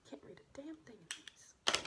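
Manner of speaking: unvoiced muttered complaint; whispered grumble. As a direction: grumble it under your breath. Muttered complaint about papers.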